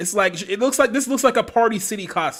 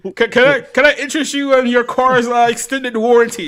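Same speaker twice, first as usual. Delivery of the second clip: high, loud Indian scam caller voice; hammed-up Indian call center accent; 6 semitones higher, 7 dB louder than usual. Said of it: "doing a stereotypical Indian accent".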